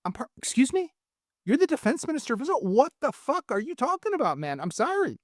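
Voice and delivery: acting out stunned disbelief at the minister; incredulous outrage. Guest mocks the defense minister's tweet, stunned and outraged. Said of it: "And you're like".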